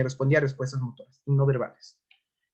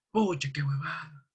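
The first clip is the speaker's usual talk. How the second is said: groggy patient; 5 dB quieter than usual. Retold or imitated. Patient reacts again.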